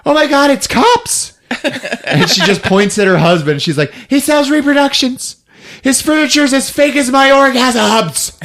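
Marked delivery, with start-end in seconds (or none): 0.1-1.4 high, loud mock shock; hammed-up high-pitched gasp of surprise; shrieked alarm. Retold shock at cops arriving. 4.1-8.3 high-pitched mock accusation; hammed-up outraged snitching; shrill denunciation. Retold wife denouncing her husband.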